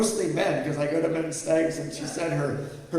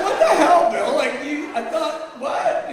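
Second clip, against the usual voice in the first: much higher and louder exclaiming; outraged disbelief; 12 semitones higher, 9 dB louder, slower than usual. Retold or imitated. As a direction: squawk in stunned outrage at Bill. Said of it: "And I was like".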